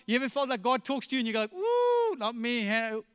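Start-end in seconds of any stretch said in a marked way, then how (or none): 1.5-3.0 a sudden very high yelp, then back down; hammed-up surprise, ducking away; mock startled yelp. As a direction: pretend to dodge in alarm. Ducking God's call, mock dodge.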